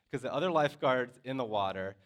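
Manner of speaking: normal talking voice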